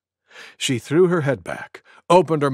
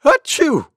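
high, loud mock sneeze; quick pretend sneeze, pitched up; monkey's sneeze; 11 semitones higher, 6 dB louder than usual. A narrator's reading. Monkey with a terrible cold, acted sneeze. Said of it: "went Monkey".

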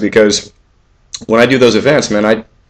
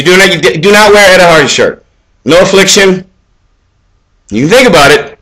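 higher, louder rant; 8 semitones higher, 4 dB louder than usual. Real, not acted. Warning older men off Ed Hardy, loud rant. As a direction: raise it and rant. Ranting dating tips to viewers.

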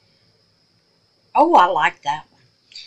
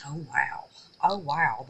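ordinary delivery

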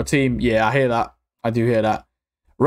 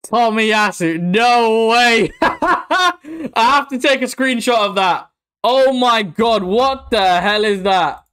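shrieking jackpot winner; 4 semitones higher, slower than usual. Real, not acted. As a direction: shriek at the lucky pull. Pack opening, lucky pull.

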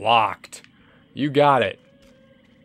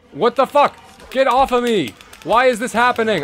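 caught-off-guard gamer panic; panicked yell; 8 semitones higher than usual. Real, not acted. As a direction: yelp it out in a panic. Grabbed by enemy.

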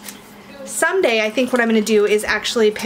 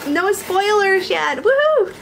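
excited cheer; 9 semitones higher, 3 dB louder, slower than usual. Real, not acted.

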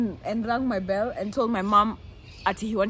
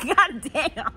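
higher, louder, laughing groan; laughing dismay; 6 semitones higher, 8 dB louder, slower than usual. Retold or imitated. Hearing dad call her, higher and laughing. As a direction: laugh out your dread. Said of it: "I was like".

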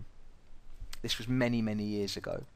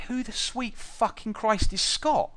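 much higher, slow, disbelieving outburst; incredulous; 11 semitones higher, slower than usual. Retold or imitated. Retelling his disbelief to his friend in the bar, a high incredulous outburst. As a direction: ask it like you can't believe your ears. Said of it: "I'm like".

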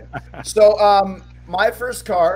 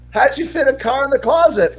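put-on incredulous kid; 8 semitones higher than usual. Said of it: "I'm like".

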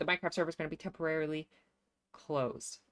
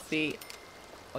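ordinary talk, nothing marked

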